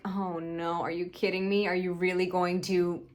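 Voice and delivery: low, hushed disbelief; quiet dismay. Retold or imitated. Viewer reacting to the character turning her anger, quiet dismay. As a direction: drop it down soft and low, dreading what comes.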